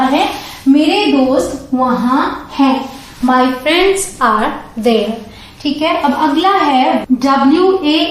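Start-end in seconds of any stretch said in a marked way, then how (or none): 3.2-5.1 very slow example sentence; slow, clear model line; slow teaching read. Shown as a demonstration. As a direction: read it slowly word by word. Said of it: "next sentence is".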